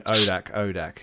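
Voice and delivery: ordinary talk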